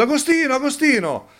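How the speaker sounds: high, repeated call of a name. Retold or imitated. Woman in the market keeps calling Agostino, high-pitched call. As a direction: keep calling him from a distance.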